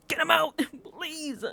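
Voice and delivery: giggling request to banish him; laughing. Real, not acted. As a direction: break into a laugh while begging. Laughing at her own plea.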